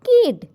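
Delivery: কিড said high and loud, stressed; emphatic word. Shown as a demonstration. Teacher drilling the word কিড, high and loud. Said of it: "K I D".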